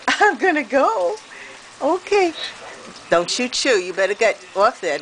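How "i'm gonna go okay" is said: much higher, playful goodbye to the bird; goodbye pet talk. Real, not acted. Saying goodbye to the birds.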